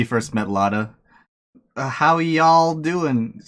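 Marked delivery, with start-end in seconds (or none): 1.8-3.4 slow, loud mock Southern drawl; mocking Southern accent. Imitating Phoenix greeting Lada in a mock Southern drawl. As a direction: ham up a Southern y'all greeting, slowly. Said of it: "different type of accent".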